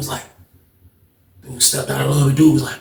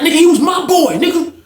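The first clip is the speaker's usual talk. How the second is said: high, indignant claim; arguing back; 11 semitones higher than usual. Retold or imitated. Rivals disputing whose friend he was, arguing back. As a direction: act out a heated comeback, pitched way up.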